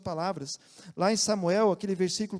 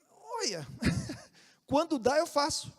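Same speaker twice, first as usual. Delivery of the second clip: higher, laughing mock excuse of a lazy believer; sheepish excuse; 7 semitones higher than usual. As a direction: shrug it off with a guilty laugh.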